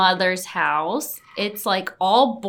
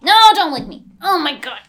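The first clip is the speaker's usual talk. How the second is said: loud protest; 4 semitones higher, 7 dB louder, faster than usual. Real, not acted. Dog licking her.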